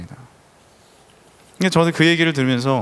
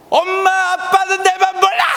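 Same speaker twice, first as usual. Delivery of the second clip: high, drawn-out storming-off yell; mock teenage cry of protest, high and slow; shouting daughter; 4 dB louder, slower than usual. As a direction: hurl it at the parents while leaving.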